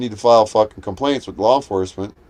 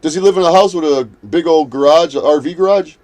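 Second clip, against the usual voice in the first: louder mocking quote, put on as his boast; mock him boasting about the garage; mocking the rival; 4 semitones higher, 6 dB louder than usual. Vlogger mocking a rival's boast, louder and scornful.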